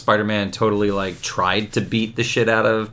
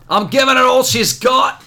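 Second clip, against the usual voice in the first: higher, put-on starship officer voice, hammed up; mock Star Trek crewman; 11 semitones higher, 4 dB louder than usual. Cohost jokes about the comic's line, in a hammed-up starship officer voice. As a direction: ham up a sci-fi bridge officer. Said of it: "Rest in peace, Chekhov".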